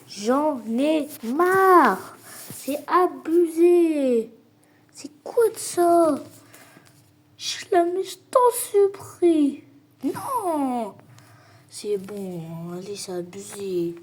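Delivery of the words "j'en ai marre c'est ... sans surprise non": drawn-out frustrated whine. Real, not acted. Raging at failed shots in game.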